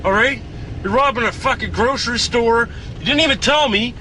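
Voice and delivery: high, heated rant; angry ranting. Acted. Man berating friends for robbing a store, angry rant. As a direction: tear into them for being dumb. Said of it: "I'm pissed off".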